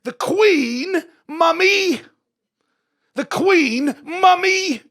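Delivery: very high, very loud regal cry; hammed-up proud shriek, vowels held long; defiant royal retort. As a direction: shout it back as an affronted queen. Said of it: "you have to respond and say".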